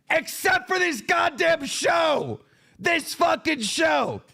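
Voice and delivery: slow, high furious yell at the show; angry shout. Real, not acted. Ranting to viewers about the show, angry shout. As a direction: bellow it, fed up.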